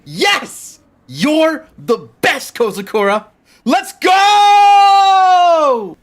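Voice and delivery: high excited cheer building to a long shout; celebrate, then holler the last word long; overjoyed cheering. Acted. Friend celebrates the agreement.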